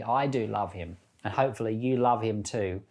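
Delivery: ordinary delivery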